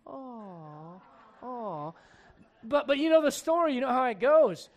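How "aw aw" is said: sweetly sentimental, hammed-up aw; mock swoon. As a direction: coo as if it's so romantic. Cooing at the romantic story.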